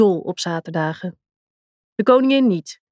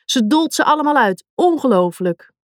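mock commentary; 5 semitones higher than usual. Girl playing commentator.

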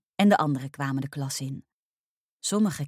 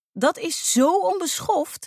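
high, loud and drawn out; indignant exclamation; 13 semitones higher, 5 dB louder, slower than usual. A narrator's reading. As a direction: call it out in outrage. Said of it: "riep ik". (verontwaardigd uitroepen)

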